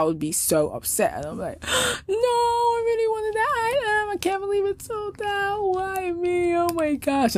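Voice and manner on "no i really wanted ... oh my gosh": much higher whiny wail, hammed-up distress; mock emotional fit. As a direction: squeal it like a spoiled kid losing a prize. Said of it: "emotional fit over it".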